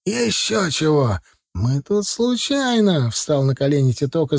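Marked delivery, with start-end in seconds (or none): 0.0-1.2 put-on drawn-out elderly retort; lightly voiced old watchman; old man's refusal. A narrator's reading. 1.5-3.1 high, drawn-out excuse; acted begging in a high voice; frightened pleading. A narrator's reading. Titok kneels, begging the gunman.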